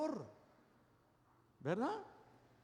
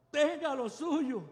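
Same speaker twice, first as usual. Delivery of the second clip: mother's blessing; 6 semitones higher, 6 dB louder, slower than usual. Retold or imitated.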